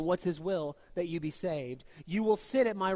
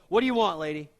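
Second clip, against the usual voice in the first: curt; 10 dB louder than usual. Pretend. Jesus imagined brushing off the mother, curt snap. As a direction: snap it like an annoyed clerk. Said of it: "He doesn't go".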